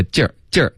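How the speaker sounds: "劲儿" as a wrong jerk, lips unrounded; "劲儿" with spread lips, Chinese-accented jerk; learner's mispronunciation. Shown as a demonstration. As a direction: demonstrate the learner's "劲儿" sound without pouting. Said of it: "会发成jerk".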